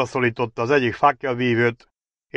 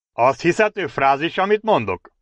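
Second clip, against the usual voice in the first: kiáltás; 8 semitones higher, 4 dB louder than usual. A narrator's reading. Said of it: "kiáltotta kardjára csapva".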